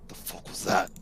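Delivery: hushed, rough whisper from the orcs; startled whisper. Acted. Orcs whispering to each other at the snapped twig, alarmed. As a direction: breathe it out low and alarmed.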